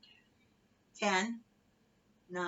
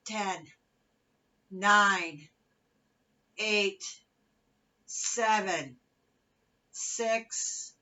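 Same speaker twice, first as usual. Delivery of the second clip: aerobics instructor; 3 semitones higher, 7 dB louder, much slower than usual. Acted. Fitness cueing and countdown.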